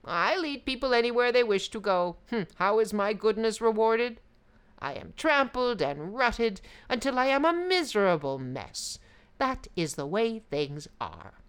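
a weary road complaining. Acted. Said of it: "The road replied".

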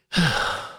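long breathy exhale; bored sigh. Acted. Storyteller as doves watching all night, bored sigh. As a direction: let out a weary sigh.

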